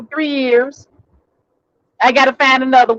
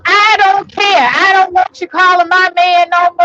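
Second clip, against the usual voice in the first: stubborn retort; 7 semitones higher, 3 dB louder than usual. Retold or imitated. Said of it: "So she goes".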